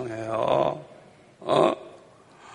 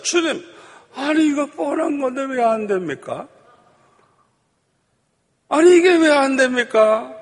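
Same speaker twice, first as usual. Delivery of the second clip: arguing in prayer; 9 semitones higher than usual. Retold or imitated. Pastor demands answers from the Lord, arguing. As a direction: take it up with God, pleading and indignant. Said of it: "따지면서 기도했어요".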